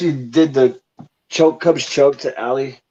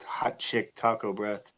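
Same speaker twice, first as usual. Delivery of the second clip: much softer, slow, under the breath; muttered joke; 5 semitones lower, 13 dB quieter, slower than usual. Acted. Joking aside to a friend, soft slow mutter. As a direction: mutter it slowly to yourself.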